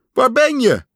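high, loud searching question; anxious calling. A narrator's reading. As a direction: call into the dark for a friend. Pat searches for missing Frog.

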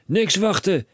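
high, loud brush-off; no time to wait; impatient cry. A narrator's reading. Father cutting off his son, high impatient call. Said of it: "riep meneer Krakeel".

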